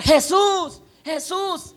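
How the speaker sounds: high-pitched cry for help, acted out; grito de auxilio. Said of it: "el hombre comienza a gritar". (grito desesperado pidiendo ayuda)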